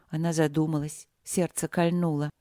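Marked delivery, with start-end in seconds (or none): none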